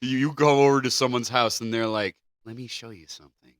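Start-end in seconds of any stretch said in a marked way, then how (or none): none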